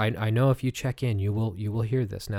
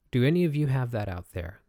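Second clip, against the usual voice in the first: normal talking voice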